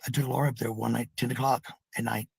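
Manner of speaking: ordinary talk, nothing marked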